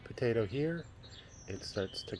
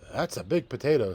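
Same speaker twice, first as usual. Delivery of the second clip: louder, satisfied farmer; farmer's voice; 3 semitones higher, 8 dB louder than usual. Acted. Farmer admiring the harvested potato, proud and loud. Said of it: "said the farmer".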